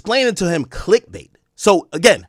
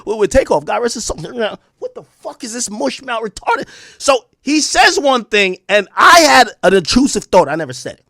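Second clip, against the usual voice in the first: usual speaking voice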